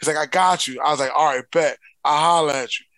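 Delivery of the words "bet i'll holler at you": ordinary talk, nothing marked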